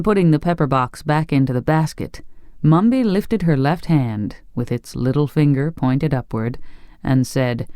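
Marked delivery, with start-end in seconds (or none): none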